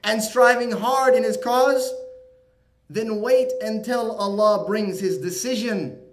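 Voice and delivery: fiery sermon reading; preaching the verse. Acted. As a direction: proclaim each item of the list. Translates the verse with force.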